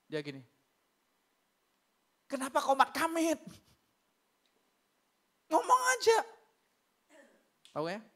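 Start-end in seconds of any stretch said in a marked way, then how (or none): 2.3-6.2 high-pitched impatient prodding; re-enacted impatient pastor; exasperated urging. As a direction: tell a tongue-tied youngster to speak up. Urging a mumbling youth to speak.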